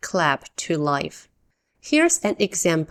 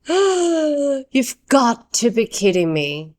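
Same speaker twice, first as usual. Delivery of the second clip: higher, slow, drawn-out disbelief; stunned disbelief; 5 semitones higher, 3 dB louder, slower than usual. Acted. Teacher acts out dropping pizza face down, in dismayed disbelief. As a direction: stretch it out, unable to believe it. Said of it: "You stare in disbelief and say".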